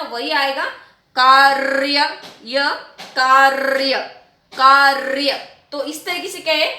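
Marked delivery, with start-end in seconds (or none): 1.1-2.1 कार्य as loud "kaarrr-ya"; long trill in कार्य, no vowel before "ya"; vowelless rolled r. Shown as a demonstration. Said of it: "जो र का रहित होता है". 3.1-5.4 कार्य as loud "kaarrr-ya"; correct rolled r. Shown as a demonstration. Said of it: "सही सही शब्द बनता है".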